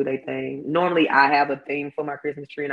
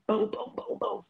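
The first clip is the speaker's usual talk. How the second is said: high mock knocks; knocking sounds; 9 semitones higher, 7 dB quieter than usual. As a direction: imitate knocking on a door. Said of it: "knocking on your door".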